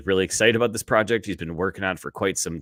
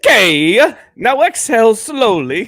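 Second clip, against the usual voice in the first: put-on higher, louder, slow, a weak Italian accent; 12 dB louder, slower than usual. Tries an Italian accent on request, badly. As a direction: stretch it out in a hammy Italian accent. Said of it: "Do it with an Italian accent".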